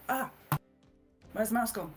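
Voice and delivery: ordinary talk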